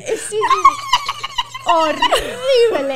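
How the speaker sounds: loud, high, shrieking laughter through words; laughing hard. Real, not acted. Guest agreeing her voice is shrill, laughing helplessly. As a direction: squeal it out while cracking up.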